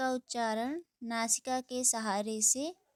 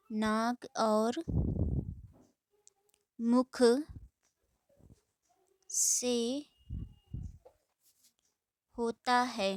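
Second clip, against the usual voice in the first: slow dictation; slower than usual. Shown as a demonstration. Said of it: "dictating word by word".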